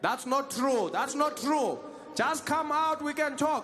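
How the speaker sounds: high, loud and slow, stretched greeting; insistent South African greeter. Acted. Specialist scolding the suspect for skipping hello.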